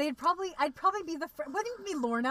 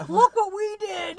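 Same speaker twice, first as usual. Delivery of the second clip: high, loud mock celebration; mock boasting; 9 semitones higher, 10 dB louder, slower than usual. Hosts crowing over this week's episode, mock boasting. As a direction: crow about it like you got away with it.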